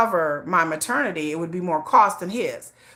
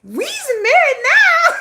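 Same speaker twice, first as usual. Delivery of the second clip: very high, slow, laughing sing-song; Color Purple quote; 7 dB louder, much slower than usual. Retold or imitated. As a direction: stretch it out, cracking up as you quote the movie. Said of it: "'Cause we like The Color Purple".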